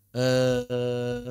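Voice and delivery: sustained droning "ehh"; long monotone vowel hum; vocal cord vibration demo. Shown as a demonstration. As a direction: hold a steady "eh" to show the buzz in the throat. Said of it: "bergetar di situ".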